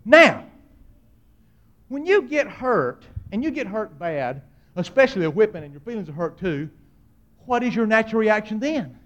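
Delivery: ordinary talk, nothing marked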